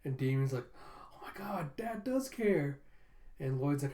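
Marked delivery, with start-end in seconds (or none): 0.7-2.8 high, slow excited whisper; hushed, drawn-out disbelief; awed whisper. Retold or imitated. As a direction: whisper it in stunned wonder. Damien reacting to his father.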